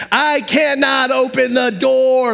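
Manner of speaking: hammed-up helpless cry; panicked plea. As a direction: drag it out, helpless and high. Arm locked, pleading with nurse.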